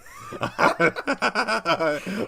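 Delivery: high, sudden burst of laughter; real laugh. Real, not acted. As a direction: crack up at your own mistake. Laughs at own confusion.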